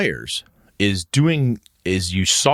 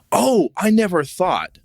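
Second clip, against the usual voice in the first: mock amazement; 5 semitones higher than usual. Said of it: "you go".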